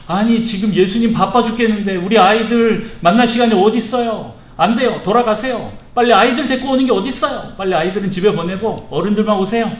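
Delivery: high, loud, exasperated telling-off; scolding disciples. Acted. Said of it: "제자들이 어른들과 아이들을 막 꾸짖었어요". (높고 큰 소리로 꾸짖듯이)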